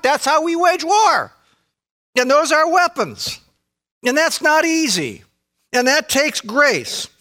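high, ringing preacher's cry; preaching climax. Real, not acted. To the congregation on waging war, high and ringing. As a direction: lift it up to the rafters.

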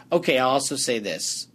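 normal talking voice

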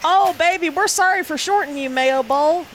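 high-pitched cooing apology; mock baby-soothing. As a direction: coo a playful apology, as to a baby. Mock-consoling a bowl game like baby.

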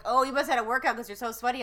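mock exclamation, loud and hurried; imitated onlookers. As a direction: imitate their loud reaction, rushing it out. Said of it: "they're like".

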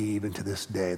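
usual speaking voice

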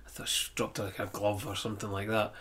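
hushed, breathy whisper under the breath; whispered aside. Retold or imitated. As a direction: drop to a secretive whisper as if thinking aloud. Musing aloud about a dropped glove.

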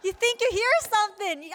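high, lightly teasing voice; amused Lord. Retold or imitated. The Lord teasing an eager believer, lightly mock-amused. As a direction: tease gently like an indulgent parent. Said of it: "the Lord ... like".

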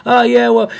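loud, high imitation of a cocky comeback; mock arrogant heckler. Heckler talking back to the comic, mocking high arrogant voice. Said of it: "arrogance out of your voice".

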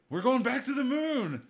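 lampoon a pompous politician; mock Trump voice. Mocking Trump's promise.

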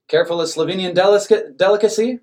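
high, loud eager offer. A narrator's reading. Manager shoving bread at workers, eager and loud. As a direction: push the treat on them brightly.

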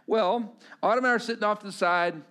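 ordinary talk

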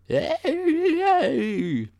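very high, drawn-out ghost wail; ghostly wind howl. Retold or imitated. Mimicking the wind to listeners, high ghostly howl. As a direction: imitate wind whistling through a crack like a little ghost. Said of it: "it comes out and goes".

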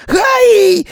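high, loud frightened yelp; startled gasp. Acted. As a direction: gasp it out in shock. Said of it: "he gasped".

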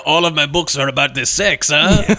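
cartoon Frenchman with a thick fake accent; hammy French seducer pushing his books; terrible French accent. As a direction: do a badly faked French accent. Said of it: "doing this terrible French accent".

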